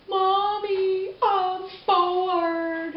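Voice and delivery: put-on high, whining kid; whiny child voice. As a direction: whine it like a bored child. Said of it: "that whiny little voice".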